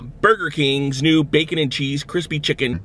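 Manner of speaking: usual speaking voice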